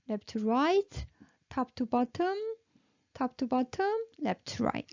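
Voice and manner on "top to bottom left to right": ordinary delivery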